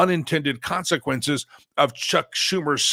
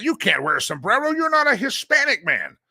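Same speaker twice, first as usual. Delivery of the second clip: mock offended scolding; 8 semitones higher, 3 dB louder than usual. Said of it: "they grow great offense to that".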